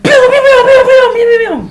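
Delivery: high loud pew pew noises; blaster sound effects. Acted. GM imitating blaster fire sounds.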